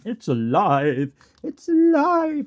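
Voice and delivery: high, drawn-out triumphant yell; dramatic cry. Acted. Streamer hams up a monster-movie cry to viewers, dramatic yell. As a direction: shout it out, stretching the word alive.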